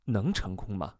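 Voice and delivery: low, soft, doubtful query; doubtful question. A narrator's reading. Therapist doubting phone hypnosis will work, low and sceptical. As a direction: ask with quiet scepticism. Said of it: "我怀疑地问".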